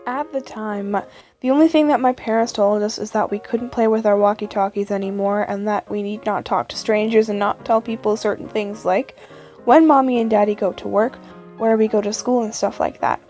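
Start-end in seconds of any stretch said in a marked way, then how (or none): none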